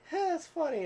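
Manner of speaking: high mock wife voice; lightly voiced wife.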